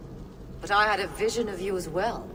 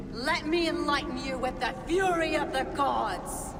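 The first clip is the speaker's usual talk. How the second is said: raised, high-pitched defiant cry; battle threat; 7 semitones higher than usual. Acted. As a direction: call down divine fury on your foe. Defiant threat before a fight.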